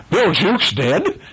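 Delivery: slow, high disbelieving question; startled cry. A narrator's reading. Pirate learning his mate is dead, startled. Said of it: "cried the startled pirate".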